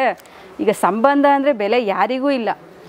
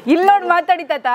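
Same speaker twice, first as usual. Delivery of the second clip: playful teasing; 4 semitones higher than usual. Real, not acted. Teasing old man about bride.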